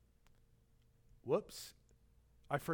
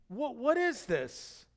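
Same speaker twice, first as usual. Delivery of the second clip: puzzled giant; 6 semitones higher, slower than usual. Acted. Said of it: "And I'm sure he's like".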